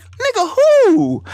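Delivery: high and loud, offended disbelief; incredulous retort. Retold or imitated. To his friend about the money, high loud disbelief. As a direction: fire it back like an insult landed. Friend says nobody makes three million.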